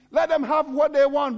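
usual speaking voice

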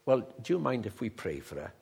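low, soft, gentle question; gentle request. Retold or imitated. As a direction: ask softly, careful not to impose. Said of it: "I just said gently".

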